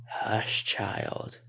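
soft, hushed creature whisper; eerie whisper. A narrator's reading. Skinwalker soothing its captive, eerie whisper. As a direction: hush her like a predator. Monster's voice in her head.

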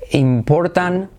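ordinary talk

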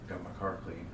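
low, soft deadpan remark; flat droning friend; monotone voice. Retold or imitated. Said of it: "he's got that monotone voice".

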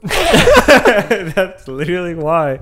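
slow words through a laugh. Real, not acted. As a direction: chuckle the words out slowly. Laughing at his own tiredness.

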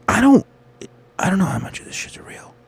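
a breathy, half-voiced whisper to himself; whispered aside. Real, not acted. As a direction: drop to a whisper, wondering aloud. Muttered aside, doubting the news.

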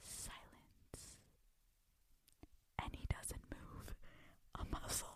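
hushed dramatic whisper. Acted. Telling the awkward silence, whispering. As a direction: whisper the suspense. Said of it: "dramatic hush".

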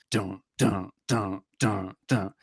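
low, soft sung bass notes; vocal bass line. Shown as a demonstration. Imitating the bass for the co-hosts, low and soft. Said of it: "starts with just the bass and drums".

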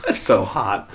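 laughing through a mock Valley Girl. Mock chart host, laughing Valley Girl. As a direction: giggle out a Valley Girl line. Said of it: "Just do, like, a Valley Girl".

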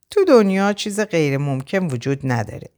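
usual speaking voice

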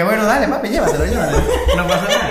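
imitating Jimmy. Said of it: "ah, como que".

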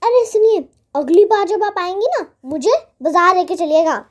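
put-on high, fast childish pleading; eager little girl. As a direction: rush the request like an excited child. Girl demands market trip.

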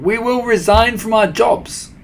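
high, loud obedient chorus; excited followers echoing orders; eager cry. A narrator's reading. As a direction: pledge it with squealing enthusiasm. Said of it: "they cried".

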